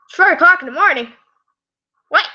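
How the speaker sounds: high, fast startled outburst; startled disbelief. Real, not acted. Reader notices how late it is, startled disbelief.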